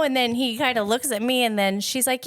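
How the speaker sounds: ordinary talk, nothing marked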